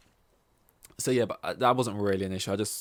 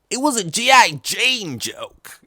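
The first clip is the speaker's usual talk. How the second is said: mock high emphatic voice; 13 semitones higher, 10 dB louder, slower than usual. Mocking the old Oscars joke.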